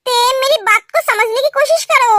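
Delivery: ordinary talk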